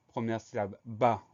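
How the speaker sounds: normal talking voice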